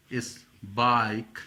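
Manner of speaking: normal talking voice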